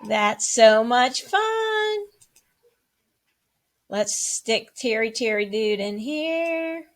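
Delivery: ordinary talk, nothing marked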